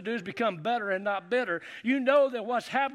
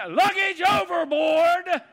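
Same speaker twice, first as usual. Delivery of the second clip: slow booming shout, higher; mock command; 9 semitones higher, 10 dB louder, much slower than usual. Wife retold as shouting the order to dump the luggage, slow and booming. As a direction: bellow it like a ship's captain. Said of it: "she goes".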